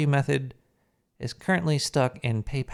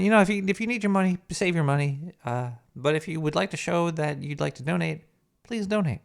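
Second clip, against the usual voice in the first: usual speaking voice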